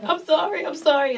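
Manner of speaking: high-pitched imitation of tearful apology; retold crying apology, half laughing; mock crying. Pleading with the bouncers after being pulled down, mock tearful.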